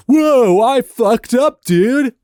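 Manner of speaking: high, loud mock panic; a hammed-up guy realizing he messed up; panicked dude. Acting out the kid lurching forward, loud mock panic. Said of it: "lurching forward and being like".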